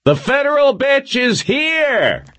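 very high, slow announcing yell; booming yell. A narrator's reading. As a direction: yell it like a ringmaster to the crowd. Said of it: "His voice boomed back off".

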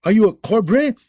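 loud, high, outraged roar; furious old lord bellowing a rebuke; wrathful command. Acted. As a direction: thunder at the knight to stand down. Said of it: "Bronze Jan rose in wrath".

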